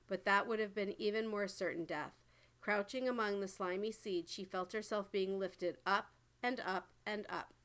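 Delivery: plain reading voice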